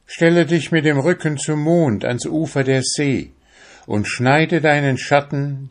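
high witch voice with a strange smile. Acted. Witch reveals the secret of the shadow, strangely smiling. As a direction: tell the secret with an eerie smile. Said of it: "mit einem seltsamen Lächeln".

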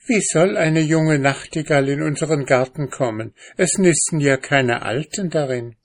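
höhere Frauenstimme, zweifelnd; skeptische Ehefrau. A narrator's reading. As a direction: zweifelt ungerührt an ihm. Frauenstimme gespielt. (Ehefrau zweifelt beim Stricken am Mann)

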